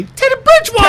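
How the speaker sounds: high excited announcer yell; hype shout. Shown as a demonstration. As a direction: shout the name like a hype man. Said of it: "when you say it, you gotta say".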